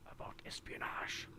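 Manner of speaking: conspiratorial unvoiced whisper. Gamer guessing the stranger's secret, whispered. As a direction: hush it like a spy secret. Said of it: "whispering the secret theme".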